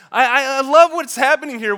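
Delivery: ordinary delivery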